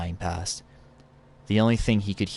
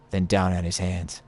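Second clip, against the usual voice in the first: usual speaking voice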